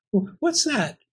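high, stammered question; frightened. Acted. Captive Pearl hearing kitchen sounds, frightened.

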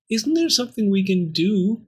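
high, anxious little voice; pleading Pearl. Acted. Captive Pearl asking the bone for help, pleading. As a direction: ask hopefully in a high voice.

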